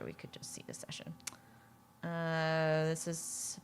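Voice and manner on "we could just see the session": whisper. Real, not acted. Muttering to herself while checking.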